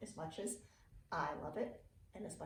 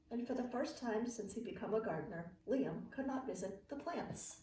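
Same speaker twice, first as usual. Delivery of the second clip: put-on reading to children. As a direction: read it like a bedtime picture book for kids. Reading a picture book aloud.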